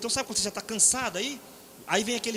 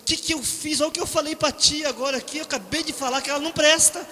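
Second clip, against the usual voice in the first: higher, louder, horrified brother; panicked retelling; 5 semitones higher, 4 dB louder than usual. Retold or imitated. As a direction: replay the brother's panic after the gaffe. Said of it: "falou assim".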